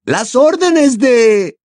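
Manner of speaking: high, loud, drawn-out indignant echo; slow, incredulous indignation in a high female character voice. A narrator's reading. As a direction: repeat it back in high-pitched outrage. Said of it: "repitió ella indignada".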